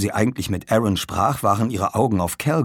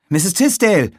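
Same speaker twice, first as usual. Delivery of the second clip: loud high call for her; 10 semitones higher, 7 dB louder than usual. Acted. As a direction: call her name up the stairs. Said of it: "rief er". (laut rufend)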